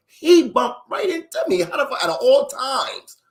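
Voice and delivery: high, loud storyteller's outcry; exasperated disbelief. Retold or imitated. Storyteller reliving bumping into dad while skipping school, exasperated. Shock at running into his dad.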